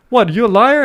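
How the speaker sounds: imitating a jeering bully; mock bully accusation. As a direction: throw the accusation like a schoolyard bully.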